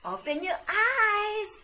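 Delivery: high and loud, bursting out; bright cheerful reveal; excited reveal. Acted. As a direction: pop the surprise open. Host reveals the surprise.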